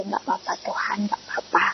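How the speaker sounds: soft, broken whispered prayer; quietly crying to God; tearful whisper. Retold or imitated. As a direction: murmur it through tears. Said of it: "Saya cuma nangis saja".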